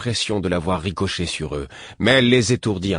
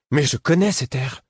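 exclaiming; 7 semitones higher than usual. A narrator's reading. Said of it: "le prince s'exclama".

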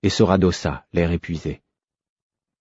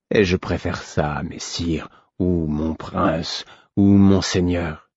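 tired prince. Acted. Vérité, weary prince voiced.

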